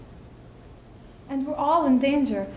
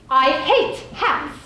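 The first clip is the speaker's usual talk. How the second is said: each word dragged out slowly; slow disgust; 3 semitones higher, 5 dB louder, much slower than usual. Acted. Widow telling why she quits millinery, slow and disgusted. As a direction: lay out the loathing word by word. Declares loathing of her trade.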